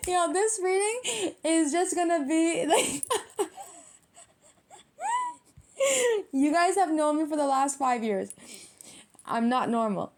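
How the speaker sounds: high voice breaking into real giggles; laughing. Real, not acted. Reader cracking up with longtime viewers, laughing helplessly. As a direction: try to talk through a laughing fit. Laughing fit during the reading.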